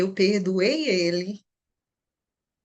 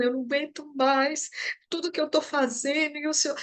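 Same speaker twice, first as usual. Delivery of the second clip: retold crying; 7 semitones higher than usual.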